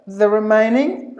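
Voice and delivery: usual speaking voice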